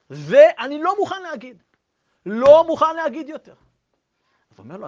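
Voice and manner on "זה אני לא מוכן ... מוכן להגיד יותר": loud, higher adamant refusal; firm refusal. Retold or imitated. Survivor refuses to say the blessing, loud and adamant. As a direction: refuse with heated insistence. Old man refusing firmly.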